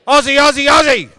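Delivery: high, rapid-fire crowd chant at full volume; loudest possible shout. Real, not acted. Leading the audience's Aussie chant, shouting loudly. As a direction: shout it out to rally the room. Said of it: "In your loudest possible voice".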